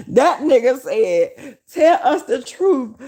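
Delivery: high, slow quote through real laughter; tickled, drawn-out retelling; laughing while quoting. Real, not acted. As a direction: struggle to get the quote out, laughing. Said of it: "This shit so goddamn funny".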